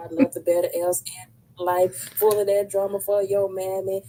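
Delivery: loud acted-out tirade; mock dismissive rant. Guest voicing what the host never said, mock rant. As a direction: imitate someone writing everybody off, loudly. Acts out dismissing troublesome people.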